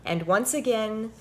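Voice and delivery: animated picture-book read-aloud; reading to children. A narrator's reading. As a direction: read it like story time for little ones.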